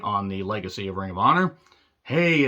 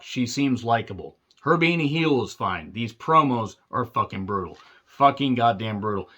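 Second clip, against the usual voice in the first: ordinary talk, nothing marked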